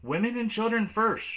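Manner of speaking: high loud shout. Acted. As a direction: call it out across the deck. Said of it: "people shouted".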